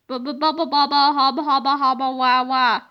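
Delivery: put-on low, dazed baby babble; babbling nonsense. A narrator's reading. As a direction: stammer helplessly like a stunned man.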